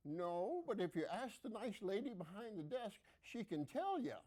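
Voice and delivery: a hint of a sweet nursing-home resident; old woman reply.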